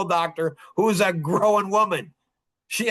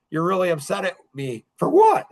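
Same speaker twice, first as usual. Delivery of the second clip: playing dumb. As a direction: act clueless, innocent. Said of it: "Like, I act dumb".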